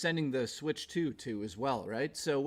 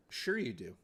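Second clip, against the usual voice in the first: sarcastic; 4 semitones lower, 5 dB quieter than usual. Acted. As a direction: stress on "you", falling: sure YOU do. Sarcastic reply to donation.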